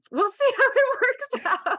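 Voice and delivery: amused, half-laughing at her own plans. Real, not acted. Author laughs at her own plans while telling host, laughing.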